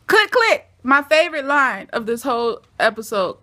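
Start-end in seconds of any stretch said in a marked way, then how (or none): 0.1-0.7 high, loud mimicked command; mock yell. Quoting a favorite line from the episode, mock yelling. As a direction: belt out both words, high and punchy.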